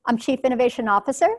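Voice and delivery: Officer lifted up like a question; rising question tune on Officer; uptalking. Shown as a demonstration. Demo of uptalk for the audience, rising end. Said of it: "So see how it sounds?".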